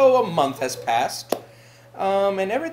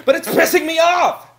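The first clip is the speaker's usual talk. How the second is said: higher, loud shout of frustration; pissed off; 8 semitones higher, 7 dB louder than usual. Acted. Housemate fed up with the cat's mess, pissed-off shout. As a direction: shout the complaint with full annoyance.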